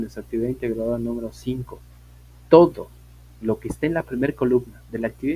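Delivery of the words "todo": Todo said high and loud as a one-word call; teacher's loud emphatic Todo. Real, not acted. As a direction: hit Todo hard to grab attention. Teacher stressing a key point.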